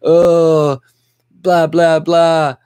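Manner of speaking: loud, high mock whining; mocking imitation of a complainer; mocking the writer. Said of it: "'cause she's like".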